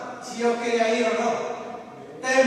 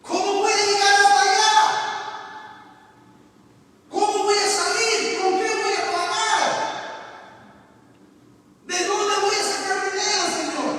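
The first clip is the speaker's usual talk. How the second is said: higher, louder pleading questions; anxious plea; 9 semitones higher, 5 dB louder than usual. Retold or imitated. Speaker retells praying anxiously to the Lord about money, higher plea. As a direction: beg God for answers in worry.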